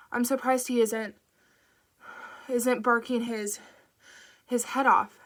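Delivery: breaking off to catch breath between words; gasping it out in short bursts. Acted. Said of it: "She had to stop every few words to catch her breath".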